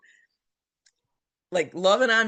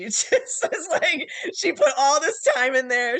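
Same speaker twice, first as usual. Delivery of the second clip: high, louder laugh-crying as the words come out; laughing through tears; 9 semitones higher, 5 dB louder than usual. Real, not acted. Team member thanking supporters, laughing through real tears. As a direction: laugh and cry at once, overwhelmed.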